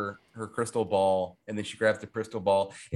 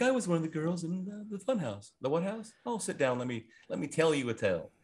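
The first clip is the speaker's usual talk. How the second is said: soft role voices; 4 semitones higher, 6 dB quieter than usual. Retold or imitated.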